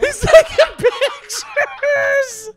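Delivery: helpless laughing wail; crying with laughter. Real, not acted. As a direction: wail it through tears of laughter. Howling laughter.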